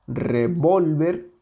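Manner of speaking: revólver said slowly, stretched on VOL; stress on the middle syllable. Shown as a demonstration. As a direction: draw out revólver, leaning on the second syllable. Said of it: "la sílaba tónica, la más intensa".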